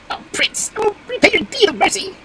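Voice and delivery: very high squeaky bird voice; high-pitched earnest bird; grateful wild duck. Acted. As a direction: pipe your thanks as a little duck. Grateful duck promises repayment.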